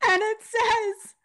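high, laugh-choked reveal. Real, not acted. Reading the wrong names to her cohost, laughing high. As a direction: squeak it out through laughter.

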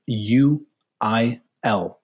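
very slow, letter by letter; spelling out. Real, not acted. Guest spells his handle for listeners, spelling out slowly. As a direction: leave space after each letter. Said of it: "I'll actually spell that out".

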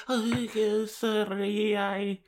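very high, small apologetic voice; imitated meek little voice; sheepish mock apology. Acting Tara snapping back, apologising.

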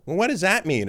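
put-on high-pitched questioning child; pestering kid. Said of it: "it's like a parent".

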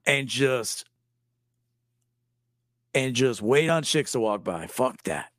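mock goth fan dismissing suits; hammed-up metalhead. Acting a goth-metal viewer.

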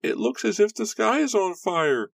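put-on very high, loud child's cry; screaming little girl. Little girl watches the blaze, high-pitched scream. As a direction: shriek it in a little girl's voice. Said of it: "screamed a little girl".

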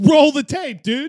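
high, loud demand; loud challenge. Real, not acted. Co-host disputing what was said, loudly demanding proof. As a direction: call it out over the others. Playful dispute over who said it.

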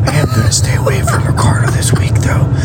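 hushed aside, half whispered; whispering. Real, not acted. Aside about avoiding Ricardo.

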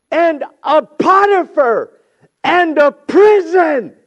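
high, slow, thundering declaration; full-force preaching. Acted. Joseph telling brothers God allowed the wound, full-force preaching. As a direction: hammer each phrase out in a loud shout.

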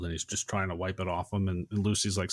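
normal talking voice

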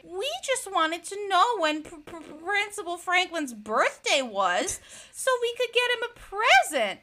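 high-pitched mock-innocent kids; kids' fibbing excuse. Kids lie to the police and principal, high mock-innocent.

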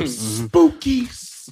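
"Spooky s-" with a very slow hissed s; spooky hissing s. Shown as a demonstration. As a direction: drag out the s like a snake. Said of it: "how [name] says all his S's".

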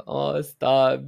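high, flattered protest; bashful coo. Retold or imitated. Podcaster brushing off listeners' compliment, bashful and high.

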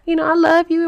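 high mock-sweet couple talk; cutesy lovey-dovey. New couple early in the relationship, high cutesy affection. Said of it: "still fresh and cute".

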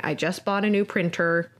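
usual speaking voice

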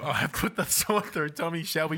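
ordinary talk, nothing marked

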